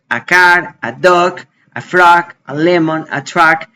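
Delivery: "a car, a dog, a frog, a lemon, a truck", each A said "ah"; Spanish A article. Shown as a demonstration. Teacher drilling "a car" to "a truck" with A as "ah". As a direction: list them with "a" as a Spanish open "ah". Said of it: "estoy haciendo la pronunciación similar".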